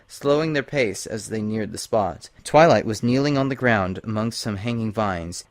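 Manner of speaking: ordinary delivery, nothing marked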